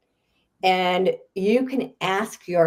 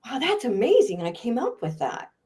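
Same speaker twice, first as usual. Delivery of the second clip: pleasantly astonished at oneself, trailing away; acted amazement; 5 semitones higher than usual. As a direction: marvel to yourself, lightly acted. Acts out admiring one's own writing.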